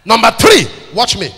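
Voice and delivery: loud, high, punchy call for attention; shouted announcement. Real, not acted. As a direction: fire it out to grab the congregation. Preacher announcing next point.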